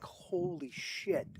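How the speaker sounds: a slow, voiceless gasp of amazement; awed whisper. Retold or imitated. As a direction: breathe it out slowly in hushed awe. Awestruck reaction to film moment.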